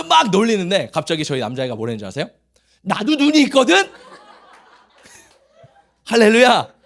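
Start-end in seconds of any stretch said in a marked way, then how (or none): 2.8-3.9 high-pitched mock indignation; imitated retort. Imitating a boy answering the teasing girls back, indignant.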